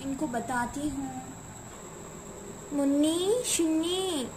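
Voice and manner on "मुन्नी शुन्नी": put-on sing-song call to the kids, drawn out; stretched-out names, calling. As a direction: call the children from another room. Mother calls her daughters.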